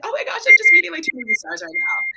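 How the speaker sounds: loud, very high fangirl squeal; acted internal screaming. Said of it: "internally, I'm screaming".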